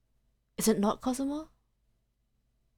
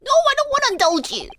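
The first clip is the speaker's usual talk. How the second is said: much higher, exasperated cry; exasperated refusal; 14 semitones higher, 4 dB louder than usual. Real, not acted. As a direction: refuse him in mock exasperation. Player refuses Holmes's offer.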